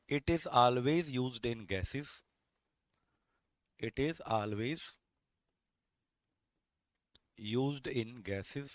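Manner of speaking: very slow, chunked with long pauses; slow dictation. Shown as a demonstration. Teacher telling students what to write, very slow dictation. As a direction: dictate it piece by piece for writing. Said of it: "तो आप क्या लिखेंगे?".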